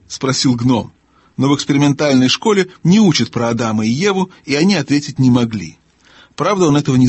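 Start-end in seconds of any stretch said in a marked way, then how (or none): none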